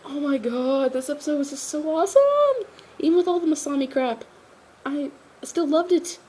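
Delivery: high-pitched, thrilled fan gush; real giddy enthusiasm; gushing excitement. Real, not acted. Gushing about the episode.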